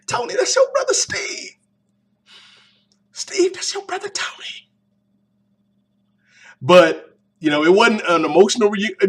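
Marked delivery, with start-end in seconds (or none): 0.1-4.7 very high, breathy, then fading into a whisper; mock sappy officiant. Reviewer playing the matchmaker between Tony and Steve, very high and hushed. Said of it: "Like, "It's".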